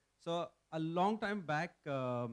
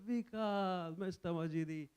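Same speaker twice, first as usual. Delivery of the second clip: higher, drawn-out names in mock affection; loving greeting; 3 semitones higher, slower than usual. Two friends hugging at the door, affectionate retold greeting. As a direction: act out greeting a dear friend with a hug. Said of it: "so much loved, hugged each other".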